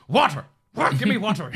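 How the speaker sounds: loud, high barked demand; barking. Retold or imitated. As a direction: bark it out, cutting them off. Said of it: "Just barks him".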